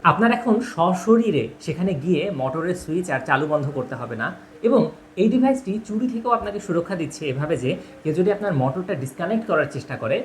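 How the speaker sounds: ordinary talk, nothing marked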